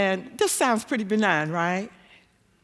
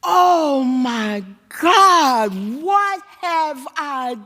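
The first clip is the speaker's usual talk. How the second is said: loud, drawn-out mortified wail; rising, pleading cry of shame; 7 semitones higher, 5 dB louder, much slower than usual. Retold or imitated. Begging forgiveness for what she has done, mortified. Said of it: "I am mortified at what I have done".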